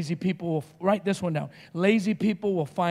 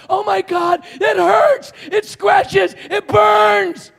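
high, loud screams of pain, acted out; tripping out; 11 semitones higher, 7 dB louder, much slower than usual. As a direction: freak out, screaming each complaint. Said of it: "I was tripping out".

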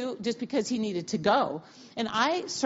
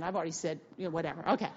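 ordinary delivery

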